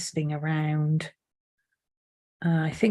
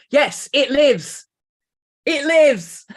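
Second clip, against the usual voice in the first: slow, louder, higher triumphant "it lives"; mad scientist cry; 8 semitones higher, 5 dB louder, much slower than usual. Acted. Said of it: "does that mean today I'm Frankenstein's monster".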